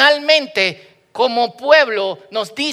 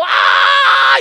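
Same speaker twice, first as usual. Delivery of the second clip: long, loud, high "aah" scream; all-out acted holler; yelling; 6 dB louder than usual. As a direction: scream out your praise at full volume. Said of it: "Y tú estás gritando".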